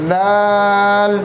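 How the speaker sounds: दाल stretched out very long; long, full दाल; lengthened letter. Shown as a demonstration. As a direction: draw out दाल slowly. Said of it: "ये भी लंबा करना है".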